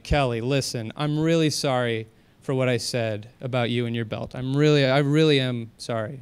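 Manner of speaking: a friend's girlfriend, apologizing with a hint of acted sincerity; fake sincere apology. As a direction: put on a sorry face and soften it. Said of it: "I'd have to do my best acting now".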